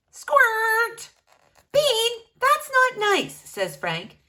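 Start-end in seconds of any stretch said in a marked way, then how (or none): 0.1-1.1 high, loud comic squirt; goofy squirt noise; squirt sound. Acted. Sound effect, read loud. 1.7-3.3 high, loud scolding cartoon voice; cartoon pal griping at his friend; Frank scolding.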